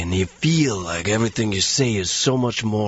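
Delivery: low, self-important lazy delivery; solemn tough-guy voice, pitched low; Steven Seagal impression. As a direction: make every word sound important, deadpan. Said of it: "You just talk this way".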